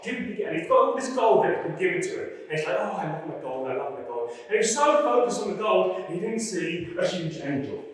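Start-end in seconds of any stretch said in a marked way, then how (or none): 2.8-4.3 low, hushed gloating to himself; doting on riches. Acted. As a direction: murmur it lovingly, low and soft. Acting Balaam doting on gold.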